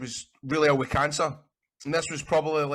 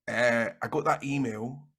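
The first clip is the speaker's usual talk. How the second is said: usual speaking voice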